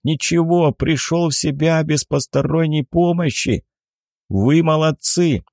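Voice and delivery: high-pitched, emphatic calling; deafened man calling out, without a full shout; loud deafened speech. A narrator's reading. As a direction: talk up high as if you can't hear yourself. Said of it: "громко произнёс он".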